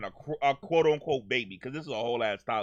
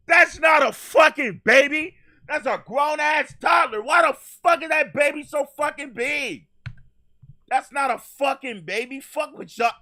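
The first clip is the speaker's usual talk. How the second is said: shouted surrender demands; 11 semitones higher, 8 dB louder than usual. Real, not acted. Outraged reaction to the bodycam footage.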